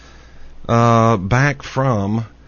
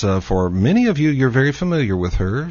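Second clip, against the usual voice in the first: normal talking voice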